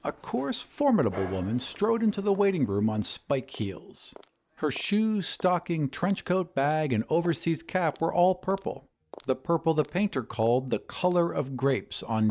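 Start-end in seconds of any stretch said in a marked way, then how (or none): none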